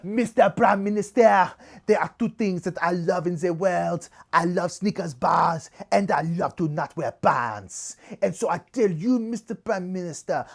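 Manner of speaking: put-on theatrical French-accented declaration; hammed-up French lady making a solemn speech. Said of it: "this is my French accent".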